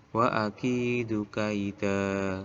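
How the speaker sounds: "kaida" ending on a long open a; drawn-out vowel closing "kaida"; long final fathah. Shown as a demonstration. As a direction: recite "kaida" slowly, no n at the end. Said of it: "dibaca biasa, fathah biasa, tapi panjang".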